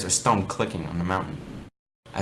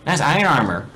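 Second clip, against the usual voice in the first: high, loud teasing jab; mock his armor; mocking compliment; 7 semitones higher, 6 dB louder than usual. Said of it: "she says".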